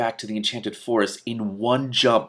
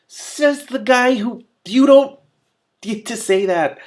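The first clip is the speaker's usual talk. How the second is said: high, drawn-out scoff at the villain; mock indignant aside; 13 semitones higher, 7 dB louder, slower than usual. Real, not acted. Reader scoffing at the villain's line. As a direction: scoff at the character's hypocrisy. Reader mocks character aloud.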